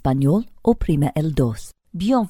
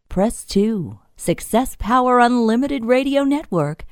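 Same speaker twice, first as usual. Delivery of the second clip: ordinary talk, nothing marked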